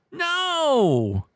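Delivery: high, drawn-out falling no; emphatic rejection. Acted. Host rejecting the guess of S, drawn-out rejection. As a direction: hold it high, then swoop down.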